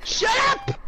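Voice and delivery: high-pitched irritated shout; exasperated yell. Real, not acted. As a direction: yell at a blaring alarm to stop. Yells at the ringing game alarm.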